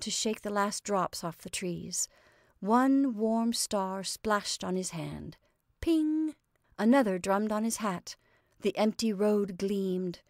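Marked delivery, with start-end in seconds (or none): 5.8-6.3 high ringing "ping"; imitated raindrop ping. A narrator's reading. Narrator mimics a raindrop hitting, high ping. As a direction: let the word ping like a drop.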